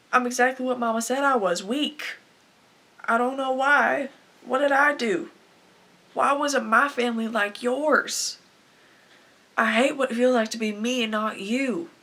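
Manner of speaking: loud, anguished self-reproach; desperate outburst. Acted. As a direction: burst out in despair, pleading for answers. Acted breakdown at end of monologue.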